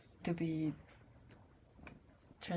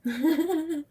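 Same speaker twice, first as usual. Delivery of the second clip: loud laugh; 8 semitones higher, 10 dB louder than usual. Real, not acted. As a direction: burst of high, loud laughter. Reacting to an adorable story.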